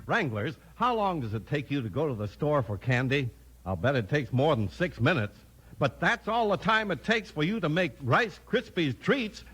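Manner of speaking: ordinary talk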